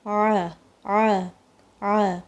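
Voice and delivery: عین pressed from the middle of the throat; ain sound. Shown as a demonstration. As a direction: squeeze the air for عین. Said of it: "پیچھے فیل ہوتا ہے".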